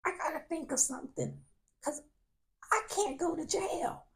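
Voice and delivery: high anxious inner voice; inner panic. Retold or imitated. Thinking how to avoid jail, anxious. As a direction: scramble for an idea. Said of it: "I'm like".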